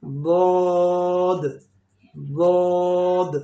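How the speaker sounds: thick heavy "बौद" held long; heavy daad. Shown as a demonstration. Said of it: "also heavy sound letter".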